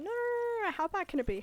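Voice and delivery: high, loud string of mock denials; exaggerated imitation of defiant denial; hammed-up protest. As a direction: burst out high, brushing off the accusation. Acting out refusal to stop.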